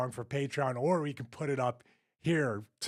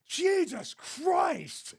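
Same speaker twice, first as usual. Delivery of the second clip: high, drawn-out mock holler; imitating a wrestler's pained disbelief; retold exasperated cry; much slower than usual. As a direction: draw out both words, pitch way up. Acting out wrestler's backstage reaction.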